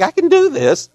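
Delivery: high, loud retort, insisting he can; mock-offended, high and loud; indignant protest. Retold argument with his son.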